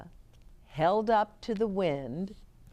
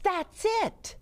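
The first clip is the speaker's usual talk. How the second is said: high, excited exclamation; sudden inspiration. A narrator's reading. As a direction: blurt it high as the idea strikes. Inspiration strikes Ruby.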